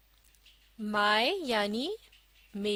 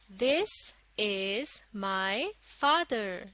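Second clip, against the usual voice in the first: very slow, word by word; slow model reading; much slower than usual. Shown as a demonstration. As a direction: read it out slowly for learners to follow. Second model reading for learners. (धीरे-धीरे, एक-एक शब्द पढ़कर)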